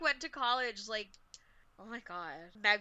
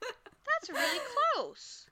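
amazed; 13 semitones higher, much slower than usual. Real, not acted. Amazed at mom's town.

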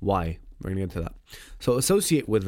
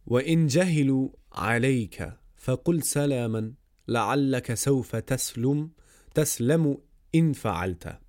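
rapid verse recitation; 3 semitones higher, much faster than usual. Acted. Recites Arabic verse of poem.

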